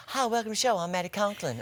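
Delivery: high-pitched Southern greeting; Southern impression of co-host. Co-host mimics her show intro, high Southern accent. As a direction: impersonate a co-host with a Southern twang. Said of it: "I made you Southern".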